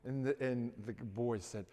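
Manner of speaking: ordinary talk, nothing marked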